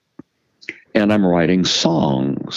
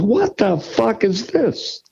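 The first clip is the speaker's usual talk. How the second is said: high, slow incredulous sneer; high drawn-out what-the-hell; mock baffled crowd; 13 semitones higher, slower than usual. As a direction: act out a confused audience. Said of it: "and people goes".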